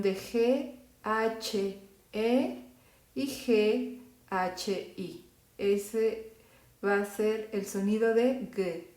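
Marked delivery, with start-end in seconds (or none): none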